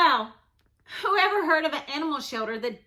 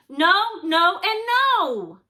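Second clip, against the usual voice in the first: louder, higher no, no, and no; firm refusal; 5 semitones higher, 6 dB louder, slower than usual. Acted. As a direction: shut down every offer. Child refuses animals firmly.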